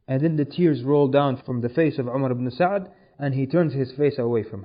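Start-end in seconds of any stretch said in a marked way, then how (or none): none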